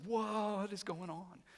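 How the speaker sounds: high, stretched-out whoa of amazement; mock bewilderment. Brothers finding their money in the bags, astonished.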